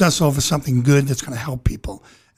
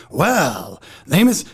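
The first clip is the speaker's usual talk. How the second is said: slow, swooping actor impression; Al Pacino; 5 semitones higher, much slower than usual. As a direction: drag out the words, pitched high, then drop for the last. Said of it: "you know, Al Pacino".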